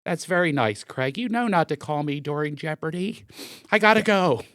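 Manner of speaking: impatient mother cutting the call short; mom brushing him off. Retold or imitated. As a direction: mom during Jeopardy, brushing her son off. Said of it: "She goes".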